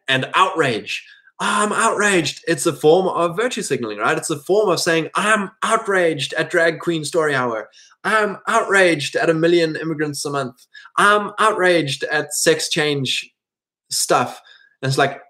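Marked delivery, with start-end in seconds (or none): 1.4-2.4 high, slow, whiny mock outrage; hammed-up huffy "I'm outraged". Mocking outraged conservatives. 5.1-14.4 whiny, raised mock complaint, slow in places; sneering parody of outrage. Mocking outraged conservatives.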